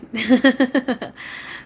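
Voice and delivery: light, high laugh at sweet teasing; giggly laugh. Real, not acted. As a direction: laugh it off, charmed. Eric being kissy.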